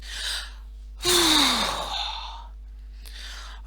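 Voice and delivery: long breathy sigh of relief; a long relieved exhale. Acted. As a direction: let out all the tension. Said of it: "Tamara breathed out".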